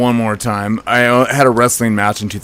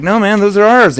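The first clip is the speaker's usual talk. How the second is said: sharp raised call, much higher and louder; 10 semitones higher, 5 dB louder than usual. Retold or imitated. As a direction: shout after him to stop. Second call to the departing guy.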